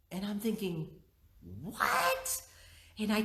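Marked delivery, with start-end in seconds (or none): 1.6-2.4 high, stunned what. Retold or imitated. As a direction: pitch the what up in disbelief. Mother baffled by outburst.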